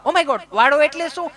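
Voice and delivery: lightly voiced fussy city woman; mimicking city lady. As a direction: ask it high and puzzled.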